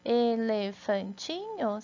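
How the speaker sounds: Elefantinho said slowly, each syllable apart. Shown as a demonstration. Teacher to class, Elefantinho in slow syllables. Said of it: "Quantas vezes a gente abre a boca".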